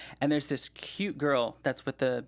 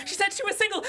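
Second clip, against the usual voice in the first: mock panic; faster than usual. Panicked excuse, repeated.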